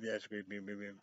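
ordinary talk, nothing marked

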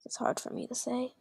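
whispered aside to herself; unsure whispered aside. Real, not acted. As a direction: drop to a breathy whisper. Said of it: "unsure about a question".

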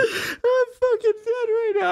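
high wheezing laugh through the words; cracking up so hard it sounds like crying; real helpless laughter. Real, not acted. Friend helpless at chair mishap.

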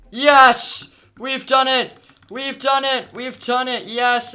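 real excited cheering; victory cheer. Real, not acted. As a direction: celebrate the win at full voice. Winning the game.

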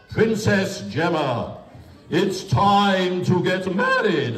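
slow fairy-tale telling; story narration. A narrator's reading. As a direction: tell it slowly like a storybook.

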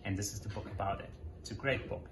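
ordinary delivery

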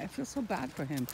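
ordinary talk, nothing marked

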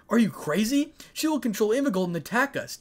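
loud, high-pitched scolding. A narrator's reading. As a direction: scold an older brother in disbelief. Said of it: "Dai scolded his older brother".

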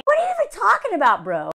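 exasperated disbelief. Real, not acted. Vlogger scoffing at the husband's reasoning, incredulous. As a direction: fire it back like you can't believe him. Reacting to his line, exasperated.

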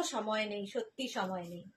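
a soft, worn-out sigh of a line; weary low aside. Real, not acted. Seller to viewers, wearily soft about having no time. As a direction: drop the voice low and soft, tired of it. Seller exasperated by too many questions.

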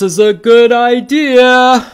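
loud, high, rising worry; mildly alarmed warning. Acted. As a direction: warn with growing alarm. Story figure warns Wilford.

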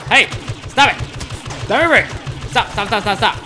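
loud frantic shouting; raging gamer. Real, not acted. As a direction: bark stop over and over. Enemy shooting him.

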